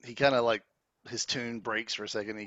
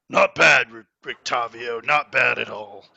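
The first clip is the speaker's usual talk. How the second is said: loud, slowed praise from the crowd; calling out; 12 dB louder, slower than usual. Acted. Man in wolf furs praises the bard, calling out. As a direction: call it over the applause.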